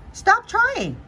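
high, loud cheeky twist; squeaky punchline. Acted. Butterfly misquoting the proverb, high squeaky punchline. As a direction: pop the wrong ending up high.

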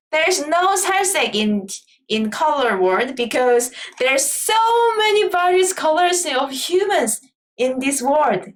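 slow, drawn-out English like an American. Retold or imitated. A Korean mimicking an American explaining skin colour, slow English. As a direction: speak it slowly like an American explaining. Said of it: "라고 외국 사람, 미국에서 얘기할지도 몰라요".

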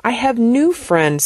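usual speaking voice

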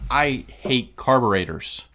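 loud, slow, emphatic hatred; passionate loathing. Real, not acted. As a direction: spell out your disgust, slowly and forcefully. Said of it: "you said that with such passion".

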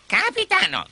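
high-pitched eager sidekick; pirate henchman. Acted. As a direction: call to the boss in a high voice. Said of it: "disse Smee".

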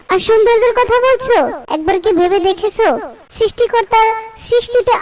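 impassioned protest. Acted.

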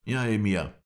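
high, loud assent; agitated old ally. A narrator's reading. Mohammed Emin answers, agitated.

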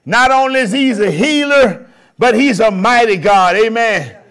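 rousing pulpit preaching. Acted. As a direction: preach it out to the whole room. Preacher proclaiming to congregation.

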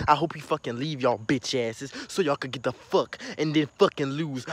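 ordinary delivery

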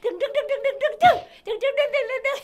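high, loud repeated "dueng" babble; squeaky rapid-fire "dueng-dueng"; flustered noise. Pretend. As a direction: rattle off bouncing high sounds.

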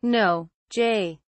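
ordinary delivery

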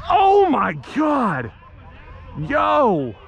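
high, drawn-out astonished cry; amazed shout. Real, not acted. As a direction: stretch each word out in amazement. Amazed by a play.

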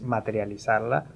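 ordinary delivery

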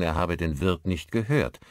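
plain reading voice

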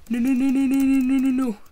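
frantic gamer panic; panicked no. Real, not acted. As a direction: yelp it over and over in alarm. Mobs appear, panicked denial.

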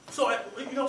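usual speaking voice

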